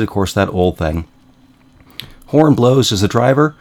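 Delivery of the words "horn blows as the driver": higher, louder setup line; quoting old joke. Retold or imitated. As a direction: toss it out as a teasing riddle. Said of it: "So I said".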